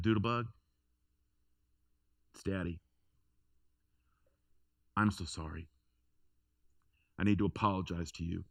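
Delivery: soft, gentle dad voice at the bedroom door; quiet, tender apology to a hurt daughter. Retold or imitated. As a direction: coax her out gently, sorry and humble.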